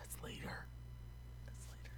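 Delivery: breathy spoiler-warning whisper. Real, not acted. Shushing a friend about a later scene, whispered. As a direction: hush it like a secret.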